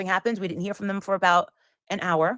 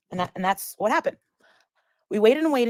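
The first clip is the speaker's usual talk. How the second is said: ordinary talk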